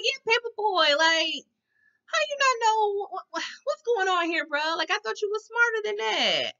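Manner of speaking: high-pitched incredulous telling-off; exasperated scolding. Pretend. Scolds the rapper in disbelief.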